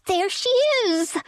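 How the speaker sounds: high piercing scream. A narrator's reading. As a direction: shriek it out triumphantly. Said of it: "screamed Prubal".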